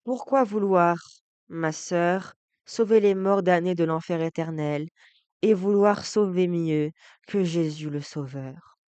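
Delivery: loud, projected poem recitation; ringing recitation of dramatic verse; forceful verse declamation. Acted. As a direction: declaim it boldly, as a challenge to a sister.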